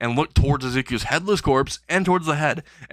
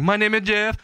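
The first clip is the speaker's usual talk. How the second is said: half-held-back scream in a raised pitch; mock scream; 8 semitones higher than usual. Jeff yelling out of the police car, mock scream. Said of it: "while screaming".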